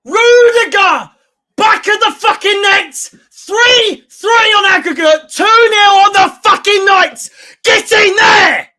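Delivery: high celebratory yell with stretched names; ecstatic goal shout. Real, not acted. Fan hailing Rudiger's header in the net, ecstatic shout. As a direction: belt it out in triumph.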